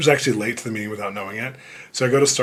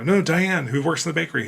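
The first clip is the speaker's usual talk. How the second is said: higher, insisting; higher insistent correction; 6 semitones higher, 3 dB louder than usual. Retold or imitated. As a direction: press the point, a bit worked up. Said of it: "And I go".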